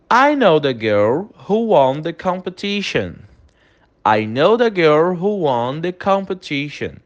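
slow, clear English model phrase; teacher modelling slowly for repetition; slow model sentence. Shown as a demonstration. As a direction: say it slowly so students can repeat. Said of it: "Repete a pronúncia comigo".

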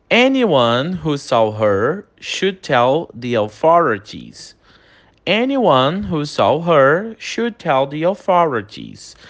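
slow, clear English model phrase; teacher modelling slowly for repetition; slow model sentence. Shown as a demonstration. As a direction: say it slowly so students can repeat. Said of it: "Repete a pronúncia comigo".

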